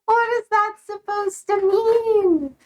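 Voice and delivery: high, slow, drawn-out indignation; mock offended. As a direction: act insulted, pitch up and stretch it. Mock offense at chat's greeting.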